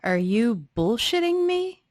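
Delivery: ordinary talk, nothing marked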